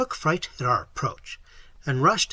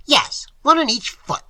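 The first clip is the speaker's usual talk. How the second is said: much higher, louder put-on voice; mocking retort; 11 semitones higher, 7 dB louder than usual. A narrator's reading. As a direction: talk down to him shrilly. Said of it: "she retorted".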